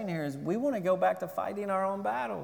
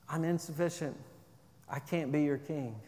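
softly, lower, a humble admission; quiet confession; 5 semitones lower, 9 dB quieter than usual. Pretend. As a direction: say it gently, confessing to your kids. Parent confessing to kids, hushed.

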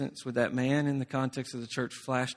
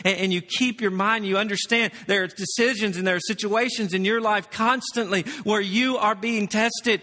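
higher and louder, driving the warning home; fervent preaching; 7 semitones higher, 3 dB louder than usual. Acted. Preacher urging the congregation, fervent. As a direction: preach it with urgency.

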